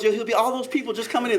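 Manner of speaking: ordinary delivery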